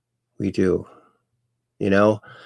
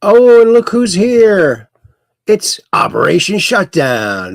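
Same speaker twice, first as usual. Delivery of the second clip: drawn-out, swooping announcer greeting; big singsong introduction that starts high and loud; showy welcome announcement; 8 semitones higher, 7 dB louder, slower than usual. Acted. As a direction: draw out a grand hello for a regular. Announces a regular's arrival in chat.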